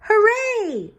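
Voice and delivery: put-on high, loud child's cheer; excited cheer. A narrator's reading. Girl on her first school day, cheering. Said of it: "said Chrysanthemum".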